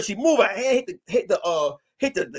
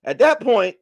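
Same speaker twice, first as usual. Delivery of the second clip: normal talking voice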